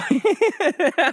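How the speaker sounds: high burst of real laughter; laughing fit. Real, not acted. Comic cracking up at his own joke, laughing. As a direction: crack up mid-sentence. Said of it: "I can't even say it with a straight face".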